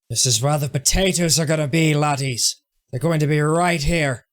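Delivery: hint of a jolly Irish farmer, laddies; Irish-ish voice; Irish accent. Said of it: "my attempt at doing an Irish accent".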